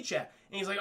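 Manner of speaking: ordinary delivery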